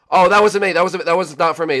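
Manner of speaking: loud, high, rushed objection; urgent protest. Real, not acted. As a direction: blurt it out in alarm. Urgent protest mid-game.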